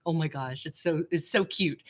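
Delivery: ordinary talk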